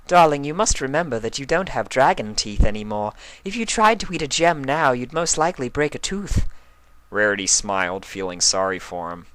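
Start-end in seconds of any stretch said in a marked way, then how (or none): 0.1-6.4 higher, refined pony lady, gently explaining; Rarity's voice. A narrator's reading. Rarity gently explaining to Spike about his teeth, refined higher voice. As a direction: elegant mare teasing kindly.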